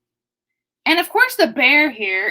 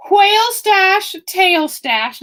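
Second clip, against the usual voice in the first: higher, louder, slow announcing; excited; 7 semitones higher, 4 dB louder, slower than usual. Acted. Reading whale page to audience, excited slow call. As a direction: boom it out like a showman. Reading animal pages aloud.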